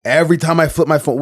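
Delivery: ordinary talk, nothing marked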